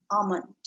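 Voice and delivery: almond said as AH-mund; silent L. Shown as a demonstration. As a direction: say almond without any L sound. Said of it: "L is kind of silent there".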